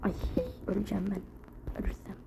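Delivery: whispering. Real, not acted. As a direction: breathe it out unvoiced. Whispered under breath.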